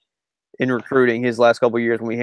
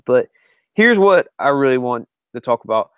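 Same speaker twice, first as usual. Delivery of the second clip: ordinary delivery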